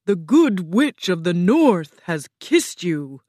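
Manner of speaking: loud, measured booming pronouncement; Oz speaking slowly. A narrator's reading. Oz tells Dorothy of the kiss, slow and booming. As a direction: boom it out with weight on each stressed word. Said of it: "the Great Oz said slowly".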